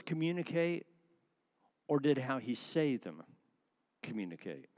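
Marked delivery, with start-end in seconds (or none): none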